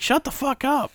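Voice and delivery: mom snapping. Retold or imitated. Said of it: "and my mom's like".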